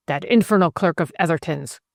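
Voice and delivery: ordinary delivery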